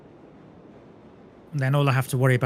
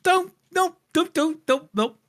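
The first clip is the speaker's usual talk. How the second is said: frantic pleading; 14 semitones higher than usual. Real, not acted. Pleading in game.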